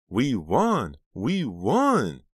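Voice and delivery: high, loud joyful shout; excited cheering. Acted. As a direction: celebrate the win. Celebrating a buzzer-beater win.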